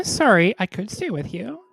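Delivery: ordinary talk, nothing marked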